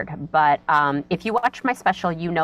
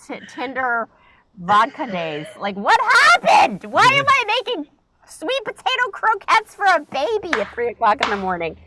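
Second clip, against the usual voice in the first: high, incredulous rant at herself; what happened to my life, cooking for a baby at 3am; exasperated self; 8 semitones higher than usual. Retold or imitated. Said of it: "And I'm like".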